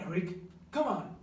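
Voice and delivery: normal talking voice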